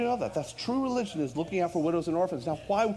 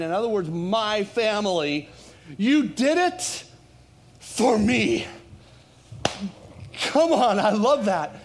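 impassioned preaching; 6 semitones higher, 5 dB louder than usual. Real, not acted. Pastor thanking his flock for doing it for Jesus, impassioned preaching. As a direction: preach it out to rally the church.

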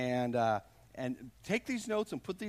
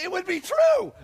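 loud emphatic high shout; 12 semitones higher, 8 dB louder than usual. Real, not acted. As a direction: preach it at full force. Preacher peaks on point.

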